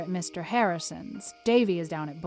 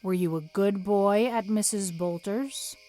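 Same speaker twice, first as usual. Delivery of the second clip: severe; 3 dB louder than usual. A narrator's reading. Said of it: "asked Marilla severely".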